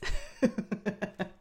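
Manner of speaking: short, self-amused laugh; real laugh. Real, not acted. Podcaster owning up to her English call, laughing. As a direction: laugh it off after a small victory. Laughs after saying she did it.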